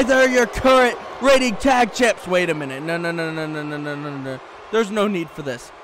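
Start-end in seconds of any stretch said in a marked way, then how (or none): 2.3-5.7 low, soft string of pleas; hushed dread. Real, not acted. Commentator dreads the next blow, pleading under his breath. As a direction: mutter in rising alarm, low.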